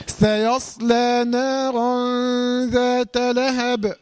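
slow, melodic tajweed chant; Quran recitation. Shown as a demonstration. Teacher recites the full verse for the student. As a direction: chant the verse slowly and sing it out.

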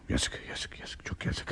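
hushed, bitter whisper; whispered despair. Acted. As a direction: breathe it out in disappointment. Father despairs over daughter. (fısıltıyla hayal kırıklığı)

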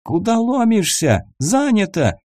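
high-pitched yell without real volume; narrated shout, pitched well up, indignant; mock yell. A narrator's reading. Captive to the visitor at the toilet door, mild yell. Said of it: "проорал парень".

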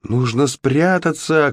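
high, drawn-out call without much volume; a narrator's stretched-out cry of alarm; urgent cry. A narrator's reading. Goldilocks warns her friends to hide, crying out. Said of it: "крикнула Златовласка".

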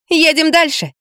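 high, lightly amused voice; smirking. A narrator's reading. Girl continuing her argument with Lampa, smirking. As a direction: move on with a touch of a smirk. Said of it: "усмехнулась девочка".

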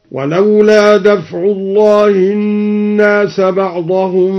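slow chant, لهدمت صوامع merged as "lahuddimaṣ-ṣawāmi'"; recitation with idgham. Shown as a demonstration. Teacher reciting al-Duri's reading, slow chant merging لهدمت صوامع. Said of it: "وأدغم التاء في الصاد".

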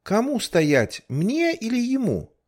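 put-on high, young boy asking; boy's voice. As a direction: pitch up into a small boy. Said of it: "спросил мальчишечьим голосом". (мальчишечий голос)

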